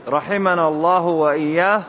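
ordinary talk

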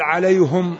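عليهم said "alayhum", with u after the h; damma on the ha. Shown as a demonstration. Teacher contrasts the readers' variants, عليهم as alayhum. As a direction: make it alayhum, short u before the final m. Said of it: "المعنى لا يتغير".

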